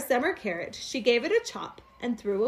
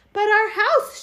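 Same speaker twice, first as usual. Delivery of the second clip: higher, louder protesting wife; fussy wife; 10 semitones higher, 8 dB louder than usual. A narrator's reading. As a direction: object like a worried little housewife. Wife objects to the tree.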